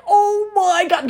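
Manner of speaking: slow, high, laughing oh my god; stunned delight at the gold bag; excited gasp. Retold or imitated. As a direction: squeal it out in shocked delight. Amazed reaction on opening bag.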